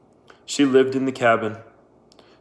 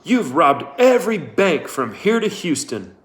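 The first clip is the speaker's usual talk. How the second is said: louder, higher accusing tone; angry accusation; 5 semitones higher, 3 dB louder than usual. Acted. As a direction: throw the charge at her. Trout accusing angrily.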